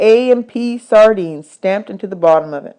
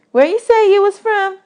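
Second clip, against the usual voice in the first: put-on much higher, bossy little girl; 13 semitones higher than usual. Desi quizzing new boys about dishes, high bossy girl voice. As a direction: boss the new boys around.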